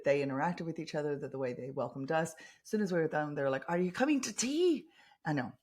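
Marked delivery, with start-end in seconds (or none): 3.7-4.9 high, louder attempt at a Scottish brogue; bad Scottish accent. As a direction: imitate the friendly Scottish pickleball players, badly. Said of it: "terrible, terrible accent".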